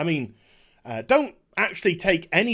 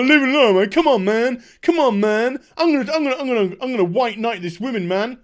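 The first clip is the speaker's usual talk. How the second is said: a louder, higher bro voice stepping in; 3 semitones higher, 4 dB louder than usual. Retold or imitated. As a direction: puff up like a self-appointed hero. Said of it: "He's like".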